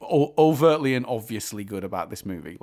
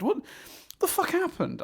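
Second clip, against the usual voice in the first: baffled; 3 dB quieter than usual. Retold or imitated. Repeating baffled reaction.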